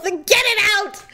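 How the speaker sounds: high-pitched panicked demand; frantic order. Retold or imitated. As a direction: keep screaming for rescue. Continues screaming at driver.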